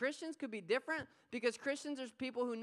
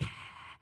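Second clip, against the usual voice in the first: mock dog huff; 9 dB quieter than usual. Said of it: "She's like".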